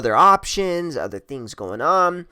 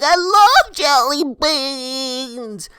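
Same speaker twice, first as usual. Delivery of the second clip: high, drawn-out mock squeal of delight; hammed-up gushing, very slow and high-pitched; giddy imitation; 12 semitones higher, 4 dB louder, much slower than usual. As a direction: stretch every word up high like an overexcited girl. Mimics her excitement over jelly beans.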